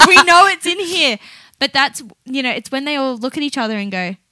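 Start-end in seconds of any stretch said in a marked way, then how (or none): 0.1-1.2 high, loud mock panic; frantic file searchers. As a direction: cry out like flustered office workers. Imagined brain workers searching files.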